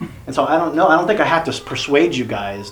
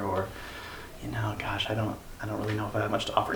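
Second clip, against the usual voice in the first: soft, unsure, trailing mumble; mock-timid group member, voice dropping away; hesitant mumble; 6 dB quieter than usual. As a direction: hem and haw like you've nothing to share. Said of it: "we all just kind of sat there like".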